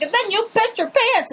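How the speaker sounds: high, incredulous accusing voice; mock outraged George. George grilling the cyclist on camera, high mock outrage. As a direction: rip into the cyclist's story, mock indignant. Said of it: "and he's like".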